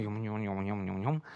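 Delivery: low, soft mumbled gibberish; imitated private muttering, words lost; mumbling to oneself. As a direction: mumble indistinctly under your breath. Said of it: "nuschelt etwas vor sich hin".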